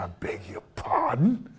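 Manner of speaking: breathy, taken-aback retort; hushed mock-indignant pardon; indignant whisper. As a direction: act offended and disbelieving. Retold indignant reply.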